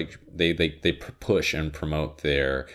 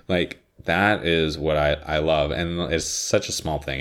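ordinary delivery

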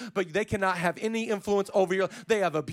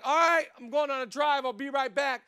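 lightly voiced grandpa, pitched up; 5 semitones higher than usual. Grandpa making an excuse to go for a drive, lightly imitated. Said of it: "So Grandpa would be like".

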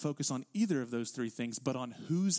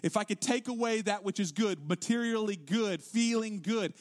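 raised, emphatic pulpit voice with higher pitch; forceful preaching; 6 semitones higher, 3 dB louder than usual. Real, not acted. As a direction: press each point home like a preacher.

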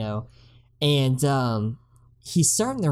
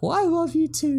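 much higher, sing-song mom voice; mock mom. Mom answering her daughter, high mock sweetness. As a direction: chirp it back like a sweet mom. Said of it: "the mom's like".

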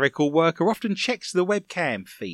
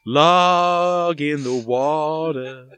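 very slow dramatic drawl; 4 dB louder, much slower than usual. Shown as a demonstration. Host savoring his own pun, very slow and grand. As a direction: stretch "Log in the water" out slowly and grandly. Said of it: "See what I did there?".

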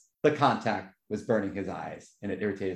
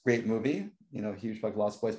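usual speaking voice

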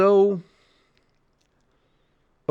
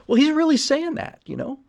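ordinary talk

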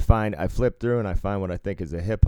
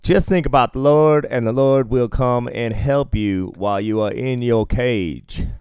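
louder, higher, slowing mock preacher; hammed-up preaching to inmates; radio preacher; 4 semitones higher, 6 dB louder than usual. Podcaster playing the Jesus DJ preaching to prisoners, mock sermon.

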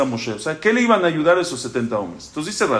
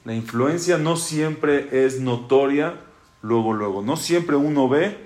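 calm him gently, unhurried; gentle reassurance; 5 semitones lower, slower than usual. Acted. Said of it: "Hashem le dice".

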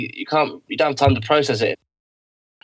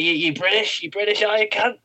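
high, pushy Aussie questioning, imitated; mock Australian accent; 9 semitones higher than usual. Coked-up Australian quizzing him in the hostel, imitated Aussie accent. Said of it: "they're like".

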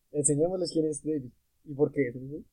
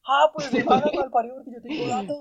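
high, defiant mock retort; desafío burlón; 7 semitones higher than usual. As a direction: throw it back as a taunt from across the room. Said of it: "yo contesto igual, y por allá suena". (réplica aguda y desafiante, imitada)

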